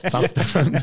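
a giggle through the words; laughing. Real, not acted. To the hosts, a laughing aside.

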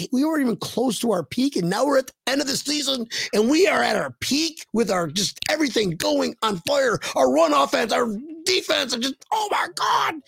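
high-pitched rant climbing into a squeal; building hype. Acted.

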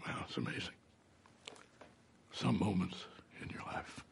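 breathy near-whisper, moved; hushed whisper. Real, not acted. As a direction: let it drop to a whisper. Emotional memory, whispered.

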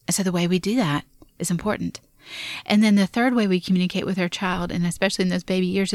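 ordinary talk

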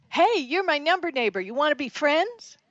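high, eager greeting; bright friendly ask. Retold or imitated. Friendly texter.

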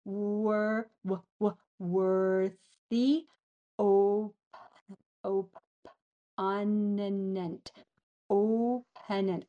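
stuck on "Wor-, w-, w-", then "opponent" stretched out piece by piece; struggling to pronounce. Shown as a demonstration. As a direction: sound out "worthy" and "opponent" very slowly, with false starts. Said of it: "I can't even say the other word".

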